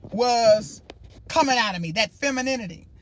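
normal talking voice

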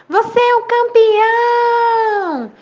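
high, loud, stretched-out echo; echoed shout. A narrator's reading. Mountain echo repeating the father's praise, slow high shout. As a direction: repeat the call long and loud. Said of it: "a voz repetiu".

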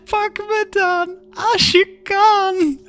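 very slow, high sing-song taunt; drawn-out crazed child's teasing, rising to a squeal; childish taunt. A narrator's reading. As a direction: taunt like an unhinged child, stretching every word. Manic patient taunts like a child.

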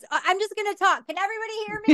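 put-on high, strained voice of an elderly person at church; mimicking old churchgoer. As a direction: imitate an old person who refuses the microphone, high-pitched. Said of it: "or then they do this".

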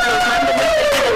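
high laughing yell; laughing shout. Real, not acted. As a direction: blurt it out through laughter. Caller laughing while shouting reply.

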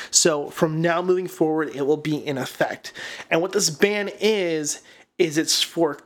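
ordinary delivery

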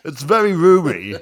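high, loud advert-presenter voice; mock advert. Hosts joking about her new tent, hammed-up advert voice. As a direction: sell the tent like a TV ad.

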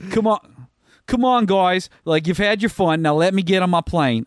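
a stranded tourist pleading in a bad Australian accent; self-mocking botched Australian accent. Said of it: "I'm about to fuck up an Australian accent".

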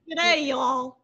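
mock Texan-Australian mix. Guest tries the Texan-Aussie mix for the host. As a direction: blend an Aussie greeting with a Texan drawl. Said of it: "Just say y'all all the time".

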